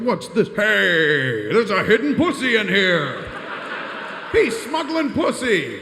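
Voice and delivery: high, loud hammed-up guard voice; mock guard outrage. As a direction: shout it like a scandalized officer. Imagined guards finding toy.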